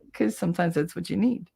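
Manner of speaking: usual speaking voice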